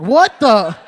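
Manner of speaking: high, loud stunned outburst; shocked what the. Retold or imitated. Comic telling his own shock at the man walking off. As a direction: blurt it out in total shock.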